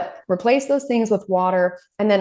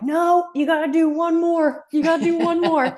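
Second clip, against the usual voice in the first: mock calling out; 10 semitones higher than usual. Adults calling to a running boy at the door, insistent. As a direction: sing it out to a tired runner.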